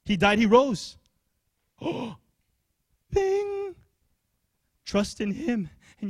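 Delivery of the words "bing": high bright gasping bing, like a light going on. Pretend. Preacher springs the punchline on the congregation, high bright bing. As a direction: pop it out high like sudden discovery. Gasp then bright exclamation.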